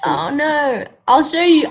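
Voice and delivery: ordinary delivery, nothing marked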